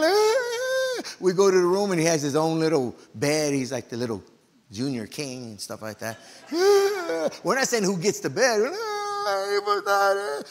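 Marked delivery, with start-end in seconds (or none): 0.0-1.0 very high, loud mock sob; high-pitched imitated child's wail; acted crying wail. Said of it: "We're crying". 6.5-7.3 high, loud imitated bawl; mock child's sob, pitched way up; acted crying wail. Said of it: "We're crying". 8.6-10.4 very high wailing words; imitated sobbing kid, pitched way up; acted crying. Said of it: "We're crying".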